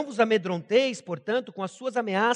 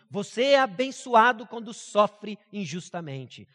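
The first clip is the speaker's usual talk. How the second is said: ordinary talk, nothing marked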